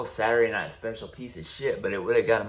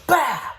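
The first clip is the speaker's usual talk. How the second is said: much higher, louder bark of impact; hit sound effect; 7 dB louder than usual. Retold or imitated. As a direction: spit out the blow as a sound. Pistol strike in the story.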